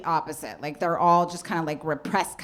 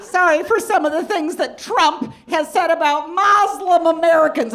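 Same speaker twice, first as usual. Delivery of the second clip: gushing mom voice; 10 semitones higher, 7 dB louder than usual. Retold or imitated. Daughter mimics her mom fawning over a cab driver's name, gushing high. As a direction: savor the name like a fine wine, way up high. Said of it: "just a full shimmy".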